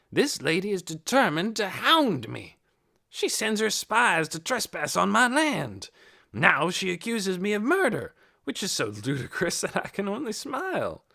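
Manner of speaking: looking down on the lady, scornful; haughty old man. A narrator's reading. Hoyt scorning Missus Beeson before the police, haughty.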